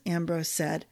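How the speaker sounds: usual speaking voice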